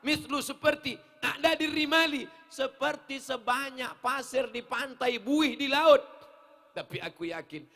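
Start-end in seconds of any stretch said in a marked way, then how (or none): 0.1-6.0 loud, raised preacher's declamation; forceful, high-pitched exposition; emphatic translating. Real, not acted. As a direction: hammer out each phrase to the crowd.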